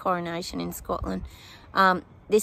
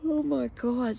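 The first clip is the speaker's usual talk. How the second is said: startled exclamation; 6 semitones higher, slower than usual. Real, not acted. Sudden exclamation mid reading.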